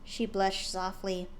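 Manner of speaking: usual speaking voice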